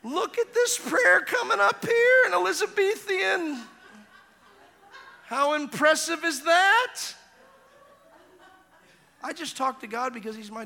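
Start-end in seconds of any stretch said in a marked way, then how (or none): 0.0-7.0 high, slow, amused angel voice; mocking angels. Archangels laughing at the prayer, high and slow. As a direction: marvel at the prayer like a smug angel. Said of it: "Michael the Archangel and Gabriel's like".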